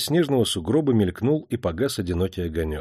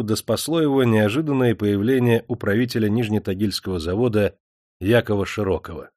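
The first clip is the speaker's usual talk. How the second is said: usual speaking voice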